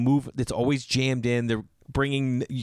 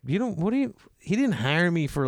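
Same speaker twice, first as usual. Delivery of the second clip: incredulous; 7 semitones higher than usual. Retold or imitated. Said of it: "I'm like".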